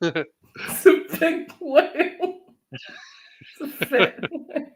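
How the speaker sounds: high squeaky giggling voice; giggly high joke voice; laughing. Real, not acted. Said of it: "Oh my".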